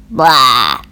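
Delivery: low, loud grunt of protest; grumpy Toad. A narrator's reading. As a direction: blurt it low from inside the house. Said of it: "said a voice from inside the house".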